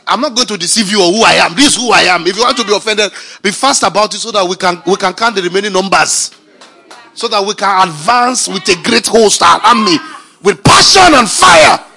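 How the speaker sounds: sermon swelling to a shouted climax; rising preaching. Acted. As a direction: build the sermon up to a fiery cry.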